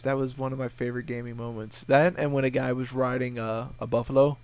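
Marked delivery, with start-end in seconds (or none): none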